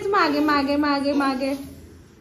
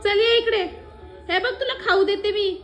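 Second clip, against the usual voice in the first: sing-song plea to come here; coaxing call; 8 semitones higher than usual. Real, not acted. Coaxing laughing toddler.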